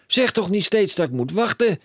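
high and loud, fed up; exasperated shout. A narrator's reading. Father snapping at his son, high exasperated shout. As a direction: stop telling me to wait. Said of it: "schreeuwde meneer Krakeel".

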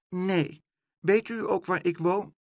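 put-on high small child's voice; a lost little boy asking timidly. A narrator's reading. Lost boy asks Pluk the way, small high child voice.

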